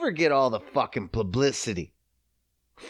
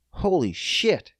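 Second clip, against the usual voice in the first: ordinary delivery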